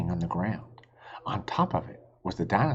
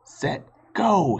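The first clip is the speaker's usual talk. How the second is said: psyching up; 9 semitones higher, 4 dB louder than usual. A narrator's reading. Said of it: "he thought".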